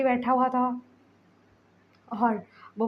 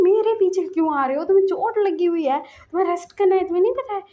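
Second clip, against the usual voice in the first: loud, higher furious scolding; angry yell; 8 semitones higher, 8 dB louder, faster than usual. Acted. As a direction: yell at him to go rest. Angry Wing scolds Lansan. (गुस्से में चिल्लाकर डाँटना)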